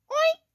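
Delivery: short high oink; pig oink. A narrator's reading. Piggy greets the truck, high oink. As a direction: squeal out a quick oink. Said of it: "said Piggy".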